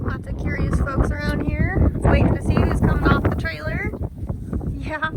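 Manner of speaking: high, loud cheerful pet voice; talking to a horse. Real, not acted. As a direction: coax the animals warmly along. Unloading horses, coaxing them home.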